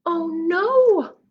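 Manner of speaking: high alarmed oh no; dismay. Acted. Reader alarmed that Puppy Truck ran off. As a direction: gasp it out in alarm.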